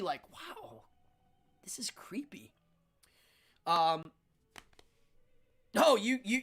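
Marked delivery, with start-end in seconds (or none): 0.3-2.5 hushed, awed aside; very soft, breath-only disbelief; creeped-out whisper. Retold or imitated. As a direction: whisper it to yourself, unnerved. Private creeped-out aside in story.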